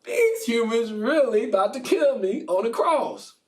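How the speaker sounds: high, loud startled realization; mock alarm at being killed; alarmed Jesus. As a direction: sound suddenly caught off guard. Jesus voiced realizing danger.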